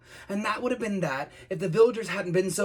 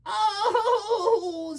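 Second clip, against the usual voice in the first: very high, long "Oh"; hammed-up high-pitched sob; sobbing wail; 3 dB louder than usual. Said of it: "sobbed the dragon".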